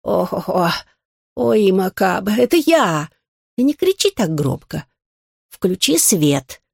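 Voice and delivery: put-on hoarse old turtle wheezing from the floor; hoarse wheeze. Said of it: "прохрипела с пола".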